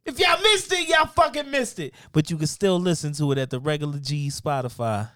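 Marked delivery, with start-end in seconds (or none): 0.0-1.9 high, loud, punchy shout; brash shouted dismissal. Real, not acted. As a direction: shout it at anyone who missed out. Brash dismissal of those who missed it.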